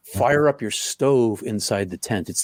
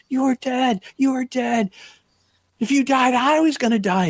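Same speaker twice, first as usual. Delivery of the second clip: much higher, desperate panicked voice; panicked crying friend; 14 semitones higher than usual. Retold or imitated. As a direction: plead through tears, terrified. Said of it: "And he's crying, and he's in a panic".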